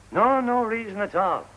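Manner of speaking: high, loud reassurance; emphatic denial. Acted. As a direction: insist too loudly that it's nothing. Covers up with forced emphasis.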